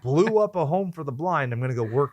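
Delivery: high, breezy mock of his reasoning; imitating movie character. Co-host mimics the hero joining the corporation, high mocking voice. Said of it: "where he's like".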